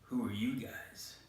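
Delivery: breathy, slow whisper of a suspicious captain; hushed whisper. Acted. Mal Reynolds stepping off his ship, whispering to the crowd. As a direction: whisper it slowly, wary of strangers. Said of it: "out comes Rogue Mal Reynolds and says".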